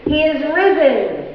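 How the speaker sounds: slow, proclaimed "He is risen" with a falling end; slow Easter call to the children; Easter proclamation. Shown as a demonstration. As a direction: call out "He is risen" slowly, like a liturgy. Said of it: "Let me say it".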